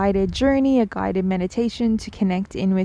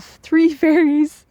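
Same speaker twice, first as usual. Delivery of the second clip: higher, louder, laughing words; amused laugh; 8 semitones higher, 6 dB louder, slower than usual. Real, not acted. Telling viewers about her fairies, amused laugh. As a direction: let a giggle break through the words.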